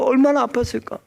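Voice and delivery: ordinary talk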